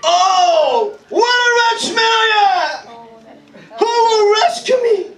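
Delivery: high, loud, anguished cry of despair; loud anguished lament; agony. Shown as a demonstration.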